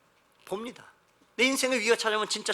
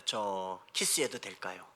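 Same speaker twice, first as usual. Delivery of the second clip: low, slow, soft question; earnest cautious groom; 9 semitones lower, 7 dB quieter, slower than usual. Retold or imitated. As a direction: ask gravely and hesitantly, drawing it out. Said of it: "늘 진지하게 묻습니다". (낮고 느리게, 진지하고 조심스럽게 묻는 신랑)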